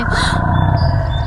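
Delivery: sharp breathless gasp; surprised gasp. Acted. Rapunzel gasps as the dinosaur returns, sharp gasp. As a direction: catch the breath in shock.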